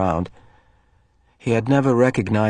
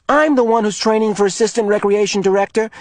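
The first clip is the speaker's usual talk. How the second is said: high, loud indignant voice; boy angrily defending his role; angry; 11 semitones higher, 5 dB louder than usual. Acted. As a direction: insist on your authority. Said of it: "Asher pointed out angrily".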